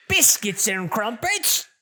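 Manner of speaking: high, hammed-up Cockney caricature; mock Cockney accent. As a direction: parody the game's fake London slang in Cockney. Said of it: "I'm obviously not British".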